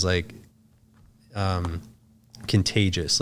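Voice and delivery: ordinary delivery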